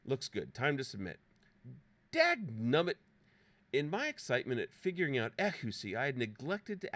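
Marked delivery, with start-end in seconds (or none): 2.1-3.0 high, loud mock curse; comic frustration. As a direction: burst out in playful exasperation. Comic curse at wrong answer.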